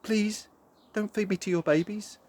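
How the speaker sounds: put-on tiny frightened girl begging; tiny girl pleading. A narrator's reading. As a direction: beg the bird not to feed you to her chicks. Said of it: "and Pinancita said".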